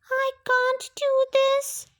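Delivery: high, frightened little fox voice; a scared little voice, almost breaking; scared whisper. A narrator's reading. Mim alone in the dark forest, high and frightened. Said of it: "she whispered".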